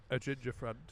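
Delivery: ordinary talk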